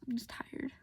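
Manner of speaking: fighting back real tears mid-sentence; holding back tears. Real, not acted. Telling how her mother treated her, close to real tears. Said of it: "I don't mean to cry".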